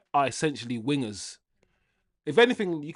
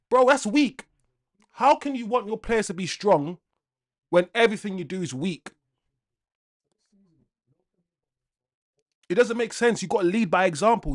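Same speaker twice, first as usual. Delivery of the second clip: higher, louder angry outburst; angry rant; 4 semitones higher, 4 dB louder than usual. Real, not acted. Fan ranting on stream about the manager, angry and loud. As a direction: let the frustration boil over. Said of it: "but I'm just angry".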